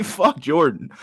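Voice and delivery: normal talking voice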